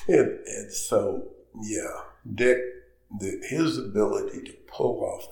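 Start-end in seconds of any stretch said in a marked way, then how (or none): none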